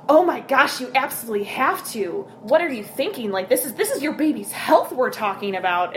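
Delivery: high, loud, shocked scolding; hammed-up outraged busybody; pushy judgmental mom. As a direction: act scandalized that she won't breastfeed. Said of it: "this is the inflection this blogger is using".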